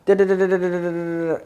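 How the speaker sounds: high-pitched mock chatter on one note; imitated yapping; mimicking girlfriend babbling. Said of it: "And she's like".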